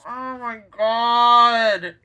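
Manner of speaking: loud, high, stretched-out exclamation; real dismay at the story; appalled outburst. Real, not acted. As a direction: cry out in outraged disbelief. Dismayed at villain's deeds.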